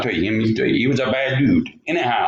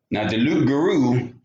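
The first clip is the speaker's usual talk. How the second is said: plain reading voice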